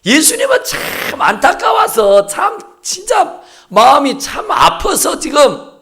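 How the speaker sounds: high, slow, urgent preaching; impassioned preaching. Real, not acted. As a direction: plead with the congregation. Preacher building emotional appeal. (간절하게 외치는 설교)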